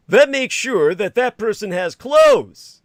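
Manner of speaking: high, loud and slow mock activist voice; mocking quote. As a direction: ham up the quote to ridicule it. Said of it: "going back to his quote".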